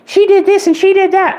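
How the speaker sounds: mock her high and snotty as she spreads rumors; mocking gossip voice. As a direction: sneer it like a petty gossip. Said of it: "lie and say".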